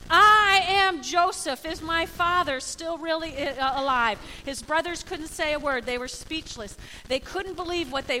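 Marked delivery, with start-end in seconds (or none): 0.1-4.2 loud, drawn-out proclamation that eases off; Joseph revealing himself. Acted. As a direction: announce yourself boldly to your brothers. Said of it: "Joseph spoke to his brothers".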